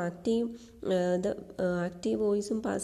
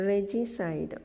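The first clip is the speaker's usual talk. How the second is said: usual speaking voice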